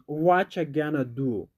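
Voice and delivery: "What you gonna do?" blended as "whatcha gonna do", the t turning to ch; natural pronunciation. Shown as a demonstration. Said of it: "Natural pronunciation".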